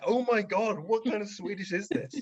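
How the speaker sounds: high, appalled gasp of disbelief; mock horror at bad Swedish; shocked onlookers. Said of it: "everyone was like".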